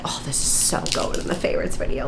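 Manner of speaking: whispered excited thought. Retold or imitated. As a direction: whisper it to yourself. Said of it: "I'm always like".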